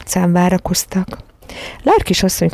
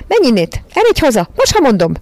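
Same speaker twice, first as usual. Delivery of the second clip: high, loud, cross command; angry shout; 7 semitones higher, 4 dB louder than usual. A narrator's reading. Miss Lark angrily ordering the dog home, high. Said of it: "dühösen integetett".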